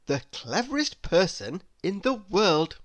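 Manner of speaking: plain reading voice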